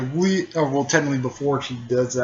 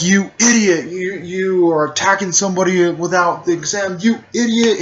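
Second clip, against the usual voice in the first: scolding shout; 7 semitones higher, 4 dB louder than usual. Retold or imitated. Guy scolds Lee in story.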